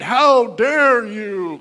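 slow, booming divine rebuke; mock heavenly rebuke, loud and slow; thundering voice of God.

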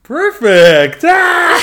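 loud, high whoop of praise; big cheer breaking into laughter; delighted cheer. Real, not acted. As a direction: celebrate the learner's success with a yell. Cheering the learner's correct sentence.

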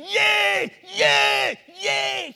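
high loud wailing "Yay" cries; Yoruba agony scream. Retold or imitated. Preacher imitating his Yoruba scream to the congregation, high wail. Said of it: "That is how Yoruba scream when they're in agony".

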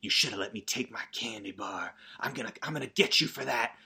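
hammed-up petty threat; cartoonishly evil girl. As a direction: menace him like a cartoon baddie. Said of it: "how cartoonishly evil they are".

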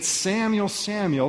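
high, ringing call across the dark; lifted calling voice of God. Acted. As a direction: call the boy's name twice, reaching out. God calling the boy by name.

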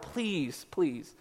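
soft, pleading aside; hushed plea. Real, not acted. Pastor begging friends about Facebook posts, softly pleading. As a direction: beg them under your voice.

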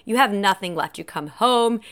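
ordinary talk

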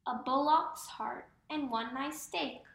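usual speaking voice

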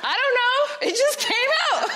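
high, loud, helpless protest; flustered excuse. Acted. Admitting the made-up name to the audience, flustered. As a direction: blurt it defensively, voice shooting up. Comic protest after Tracy confession.

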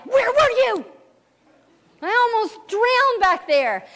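put-on high, slow childish outrage; indignant little girl. Daughter scolding dad after nearly drowning, high childish outrage. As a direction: whine it like a furious kid.

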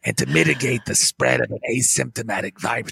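detached and boring, full of hesitations; low droning official with long uhs; Fauci impression. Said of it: "sound like it's kind of boring".

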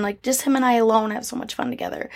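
normal talking voice

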